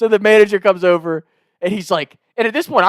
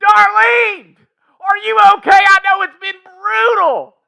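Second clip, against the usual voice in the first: very high, loud mock holler; screaming back; 6 dB louder, slower than usual. Prank caller screams to his wife off the phone, mock holler. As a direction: scream to someone in the next room, drawn out. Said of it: "I'm screaming back".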